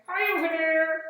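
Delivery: high, loud, eager puppy-like puppet; excited puppet voice. Acted. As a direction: burst in like a puppy thrilled to see children. Puppet Rufus waves to kids.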